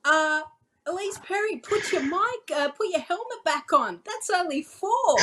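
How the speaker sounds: imitating own startled correction on the mic; re-enacted commentary call. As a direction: replay your on-air call in a high, strained pitch. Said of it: "And then I'm like".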